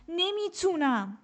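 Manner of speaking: high, slow strained cry of protest. A narrator's reading. Exhausted pupil crying out against her teacher. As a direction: cry out that you can't go on. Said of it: "اعتراض کردم".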